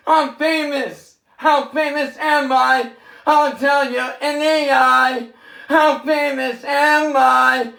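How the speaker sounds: a hammed-up show-off shout; mock boast. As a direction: crow about being famous, hammed up. Boasting, louder and higher.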